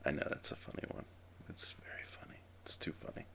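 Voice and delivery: a sly whispered aside to the camera. Real, not acted. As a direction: hush it like a secret boast. Mock-modest aside after joke.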